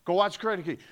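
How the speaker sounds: normal talking voice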